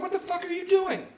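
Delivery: high-pitched disbelief, without extra volume; incredulous confrontation. Acted. Son-in-law catching her smoking in the house, incredulous. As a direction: squeak out your outrage.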